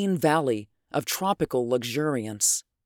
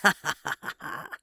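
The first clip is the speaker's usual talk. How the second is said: ugly evil laugh; 4 semitones higher, 4 dB quieter than usual. Acted. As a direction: chuckle with cruel meaning. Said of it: "His laugh was ugly with significance".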